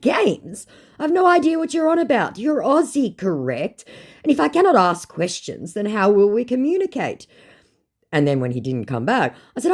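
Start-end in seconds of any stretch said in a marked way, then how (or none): 0.0-7.3 prim mock innocence. Her own retort, acted.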